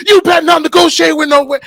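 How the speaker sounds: loud, high shouted order; sergeant shouting. Retold or imitated. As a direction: bark it down at him.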